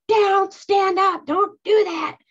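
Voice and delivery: high, loud, slow barked order; inner command. Retold or imitated. Her inner voice ordering her body to stop. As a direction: bark it like an inner drill sergeant. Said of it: "inside I'm like".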